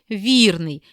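«вірний» said with the first syllable ВІР stronger and longer; «ві́рний» with stress on the first syllable. Shown as a demonstration. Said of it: "з більшою силою і довготою вимовляємо перший склад".

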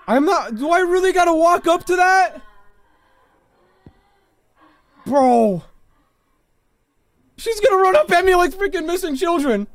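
high, loud, frightened complaining; scared streamer shouting at the game; panicked shouting. Real, not acted. Dreading walking up to ghost.

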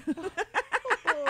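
high, loud cackle; big laugh. Real, not acted. Host after her joke of the day, cackling loudly. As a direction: crack up at your own punchline.

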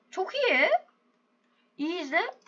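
ordinary talk

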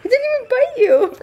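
high mocking quote of a partner; imitating partner. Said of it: "He's like".

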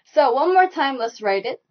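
ordinary talk

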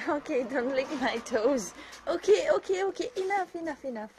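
high, loud flustered protest; laughing protest. Real, not acted. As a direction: fend off the licking, high. Dogs licking, she protests.

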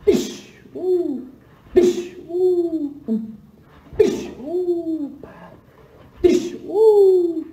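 sharp "ish" hits and high "ooh" squeals; punch sound effects. Pretend. Streamer voicing punches while he plays, high yelps. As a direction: voice each punch landing with a yelp.

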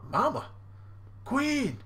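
high, surprised questioning; startled Smoothie. Retold or imitated. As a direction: react to the boss arriving unannounced. Smoothie startled by Big Mom.

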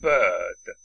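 S=bird; correct vowel demo. Shown as a demonstration. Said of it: "نگید بد".

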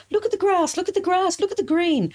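high, rapid excited exclamations; excited child's yell. Retold or imitated. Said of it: "yelling at my mother".

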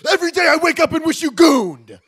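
high, loud furious yell. Acted. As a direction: scream it in despair. Continuing movie yell.

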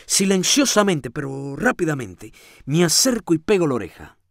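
ordinary delivery, nothing marked

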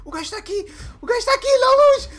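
building from alarm to high loud shouting; rising panic. Real, not acted. Monster found, rising panic. (pânico crescente)

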